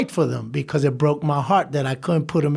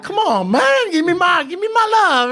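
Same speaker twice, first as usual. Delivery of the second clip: high-pitched playful plea, laughing; pleading high; 13 semitones higher, 4 dB louder than usual. Retold or imitated. To fellow inmates asking for love, high laughing plea. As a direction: whine like a disappointed celebrity. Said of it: "I'm like".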